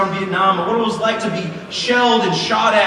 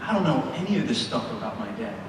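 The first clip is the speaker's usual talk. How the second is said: lower, softer, stunned inner thought; quiet realization; 10 semitones lower, 8 dB quieter than usual. Retold or imitated. Son realizing how little he knew his dad, hushed. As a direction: say it to yourself in wonder. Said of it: "And I thought".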